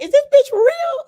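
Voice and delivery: very high, loud squeal of disbelief; inner voice, disbelief. Retold or imitated. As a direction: shriek it like you can't believe your ears. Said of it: "in my head, I was like".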